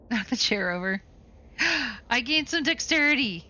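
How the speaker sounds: ordinary talk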